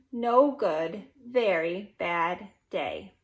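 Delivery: ordinary delivery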